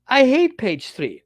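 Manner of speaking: high, loud, drawn-out complaint; slow, emphatic mock outburst; frustrated retort. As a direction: protest it loudly, stretching the words out. Acted retort of a frustrated owner.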